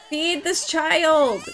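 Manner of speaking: loud, slow, pleading yell at the screen; drawn-out exasperated cry; frustrated shout. Real, not acted. Frantic yelling at unfed Sim.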